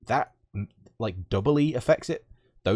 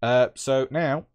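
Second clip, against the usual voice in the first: normal talking voice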